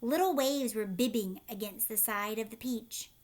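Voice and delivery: usual speaking voice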